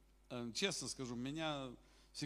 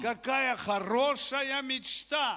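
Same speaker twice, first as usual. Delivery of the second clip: much higher, louder, slow shout of praise; jubilant hallelujah; 7 semitones higher, 5 dB louder, slower than usual. Real, not acted. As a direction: cry it out in triumph. Preacher exulting over David's dream.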